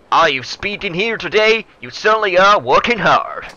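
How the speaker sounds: loud, high Texan politician; Texan accent. Acted. Senior politician chats to younger rival, loud Texan accent. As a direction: boom it out in a hammed-up Texan accent. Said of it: "offended with that accent".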